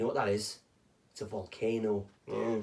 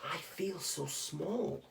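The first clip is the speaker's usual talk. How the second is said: much softer, slow and small; tiny awed voice; 13 dB quieter, slower than usual. Acted. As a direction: whisper it in awe at the big world. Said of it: "she said to the whale".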